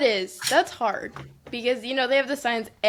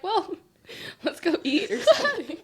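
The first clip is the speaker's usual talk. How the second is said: casual walk-away quote; 9 semitones higher than usual. Retold or imitated. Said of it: "been like".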